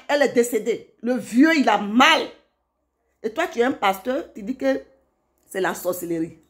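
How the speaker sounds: ordinary delivery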